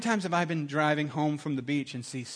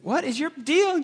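high, louder giggly mimicking; mock him through giggles; giggly echo; 12 semitones higher, 6 dB louder, slower than usual. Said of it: "he's like".

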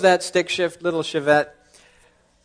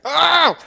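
exasperated oh; 6 dB louder than usual. Retold or imitated. Exasperated cry.

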